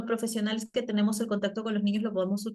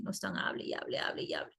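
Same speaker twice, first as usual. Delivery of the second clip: whispered aside; 9 dB quieter, faster than usual. Retold or imitated. Children chattering while eating.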